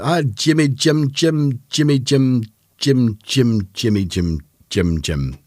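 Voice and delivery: loud, high Scottish film impression; Scottish accent. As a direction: belt out the names in Scottish, dropping at the end. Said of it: "I'll do the Scottish accent".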